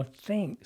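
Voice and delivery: high "Thank" said as "thenk"; smiled "eh" vowel. Shown as a demonstration. Voice coach shows the vowel shift, "Thank" pitched high with an "eh". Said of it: "the ah sound now becomes an S sound".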